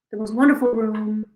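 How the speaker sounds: a hint of Trump bragging about the room; Trump impression. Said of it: "Are you ready for my Trump impression?".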